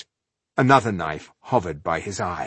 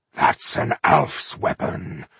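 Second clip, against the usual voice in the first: low, slow whispered growl; 5 semitones lower, slower than usual. Acted. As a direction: growl it under your breath. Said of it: "growled Ironheart".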